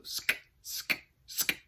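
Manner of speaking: soft, voiceless "sk" scratches; beatbox slow, separate scratch hits with no voice; beatboxed scratching sound. Shown as a demonstration. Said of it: "a scratching kind of sound".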